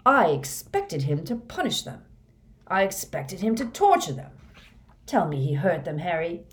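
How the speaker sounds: forceful, bitter tirade with loud bursts; vengeful rant. Acted. Moody vents hatred of traitors to Harry, vengeful. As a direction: hammer out the grievance with fervor.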